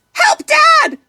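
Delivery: high, frightened little-fish cry; cry for help. A narrator's reading. Little fish calling his dad while netted, panicked cry. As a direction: shriek for help as he's caught. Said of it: "is all Nemo has time to say".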